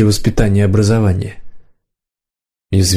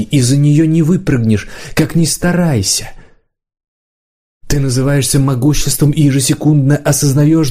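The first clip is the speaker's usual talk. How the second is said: higher-pitched, driven tirade; bitterly impassioned Подставка; 4 semitones higher than usual. Acted. Подставка lecturing Варан on the limits of magical power, bitterly impassioned. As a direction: press each point home in a raised pitch, drawing out the end.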